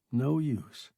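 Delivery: plain reading voice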